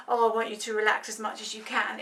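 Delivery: ordinary talk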